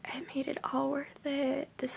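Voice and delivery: soft whispered relief; whispered relief after praise from coworkers; relieved whisper. Retold or imitated. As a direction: breathe it out, relieved. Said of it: "I was like".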